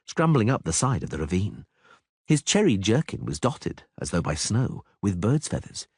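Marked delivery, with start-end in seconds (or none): none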